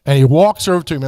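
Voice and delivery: normal talking voice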